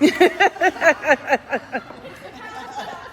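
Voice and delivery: struggling to read for laughing; laughing while reading. Real, not acted. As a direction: push the words out mid-laugh.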